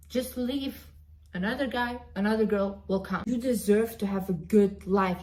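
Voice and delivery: ordinary talk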